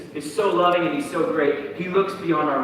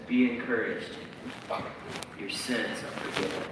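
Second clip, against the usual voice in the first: gentle Jesus; 7 semitones lower, 13 dB quieter than usual. Acted. Jesus gently forgiving the paralyzed man, soft. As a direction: say it tenderly and let it land. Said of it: "He says".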